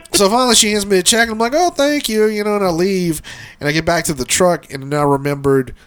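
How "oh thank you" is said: high-pitched, overly polite; mock polite thanks. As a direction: put on a politeness to get away. Retold polite thanks while uncomfortable.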